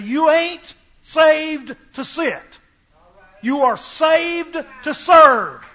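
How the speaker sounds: slow, hammered-out motto with every word stressed; slow, deliberate, preachy emphasis; emphatic. Retold or imitated. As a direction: drive each word home slowly like a mentor's catchphrase. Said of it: "Neil used to say very emphatically".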